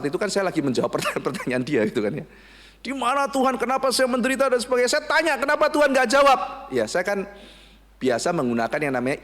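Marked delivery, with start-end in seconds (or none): 2.8-4.9 put-on high, fast, whiny teen voice; complaining teen. Teen complains God never answers. 4.9-6.4 high, louder plea; a teen demanding an answer; complaining teen. Retold or imitated.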